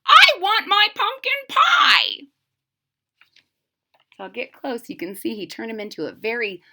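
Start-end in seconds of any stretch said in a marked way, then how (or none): 0.1-2.3 put-on high, loud old-man croak; croaky old man. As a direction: moan it out like an ancient man.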